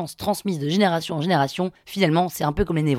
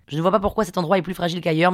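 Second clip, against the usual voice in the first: usual speaking voice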